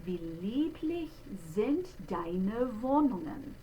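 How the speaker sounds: slow recitation of "Wie lieblich sind deine Wohnungen"; German diction. Shown as a demonstration. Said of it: "So the very first line".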